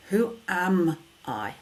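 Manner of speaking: slow WHO AM I with AM drawn out and weighted; measured, self-affirming question stressing AM; emphasis on AM. Shown as a demonstration. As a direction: lean on AM, spacing each word deliberately. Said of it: "Change the emphasis".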